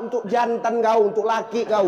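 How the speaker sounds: usual speaking voice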